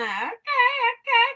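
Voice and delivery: stretched high sing-song okay; slow playful high okay; high drawn-out okay. Real, not acted. Greeting chat on live stream.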